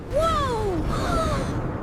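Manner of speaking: high, drawn-out awed whoa; amazed whoa. Acted. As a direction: let the wonder soar up. Second awed reaction, changed voice.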